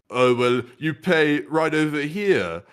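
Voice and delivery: slow, drawn-out British attendant voice; car park attendant. Retold or imitated. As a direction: imitate the attendant's slow, lightly British reply. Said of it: "And the guy was like".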